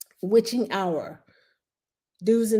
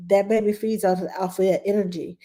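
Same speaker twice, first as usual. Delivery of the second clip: ordinary delivery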